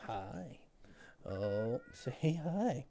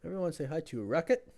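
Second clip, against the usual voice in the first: excited host; 4 semitones higher, 6 dB louder, faster than usual. Real, not acted. Presenting cat to chat.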